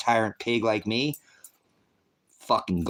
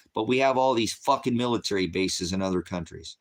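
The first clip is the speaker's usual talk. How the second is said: usual speaking voice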